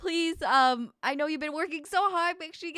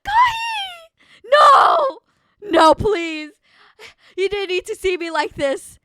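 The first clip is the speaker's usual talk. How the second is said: anguished shout; 6 semitones higher, 10 dB louder, slower than usual. Real, not acted. Chat saw streamer upset.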